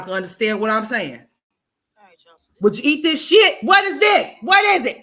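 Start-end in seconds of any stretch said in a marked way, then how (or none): 2.6-5.0 high, loud shocked questions; can't believe this plate; high loud disgusted shock; outraged disbelief. Real, not acted. Outraged at the pictured meal.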